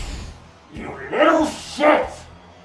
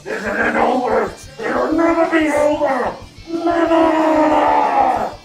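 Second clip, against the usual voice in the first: villain shout; 5 semitones higher, slower than usual. Acted. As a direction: bellow the vow at the hero. Defeated villain vows revenge.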